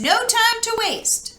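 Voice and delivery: high, hurried urging; urgent. Acted. Nickowitz hurrying his siblings, urgent and high. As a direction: push them, no time to lose. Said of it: "cried Nickowitz".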